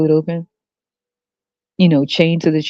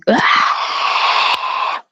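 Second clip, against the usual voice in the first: long high wail; imitated baby crying; 6 semitones higher than usual. Hungry baby wailing at tired mother, mock cry. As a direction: mimic a crying infant at length. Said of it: "The kid is crying".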